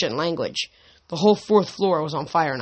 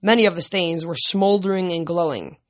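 ordinary talk

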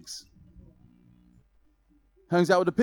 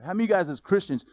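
usual speaking voice

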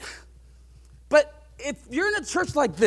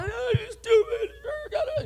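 mock furious kid; 10 semitones higher, much slower than usual. Angry kid after being dunked.

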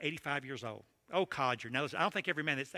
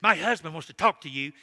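loud angry burst at the preacher; mad as a hornet; 2 semitones higher, 10 dB louder than usual. Retold or imitated. Angry young wife confronting the preacher after his talk, loud. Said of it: "came up to me mad as a hornet".

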